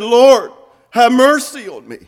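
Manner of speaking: loud, high, fervent plea; impassioned shout to the Lord; crying out. Acted. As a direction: raise your voice and cry out for mercy. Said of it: "He cried out and said".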